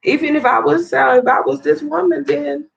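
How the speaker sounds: high-pitched, heated accusation; real fury, voice pushed up high; hurt and outraged, pitch climbing; furious rant. Real, not acted. Confronting the man who hit her.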